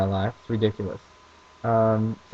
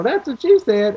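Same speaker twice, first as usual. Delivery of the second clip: high, louder mock dad voice; dad's joke; 11 semitones higher, 5 dB louder than usual. Imitating dad repeating his joke, high and loud. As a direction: repeat dad's line in a hammed-up voice. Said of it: "that's what he, you know".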